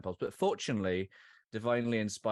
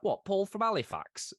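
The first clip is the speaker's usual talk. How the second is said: high mock Yorkshire son; a light Yorkshire twang on the son's question; imitating Yorkshire accent; 11 semitones higher, 3 dB louder than usual. Dad imitating his son in a Yorkshire accent. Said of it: "with the accent".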